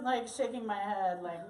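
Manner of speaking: usual speaking voice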